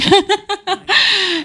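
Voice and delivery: high burst of laughter. Real, not acted. Player cracks up at her character's greeting, high laugh.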